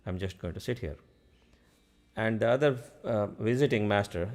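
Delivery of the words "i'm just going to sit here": softly to himself, lower, resigned; a hint of a resigned younger brother, murmured; quiet resigned inner voice. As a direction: mutter it to yourself, a little down. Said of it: "He was like".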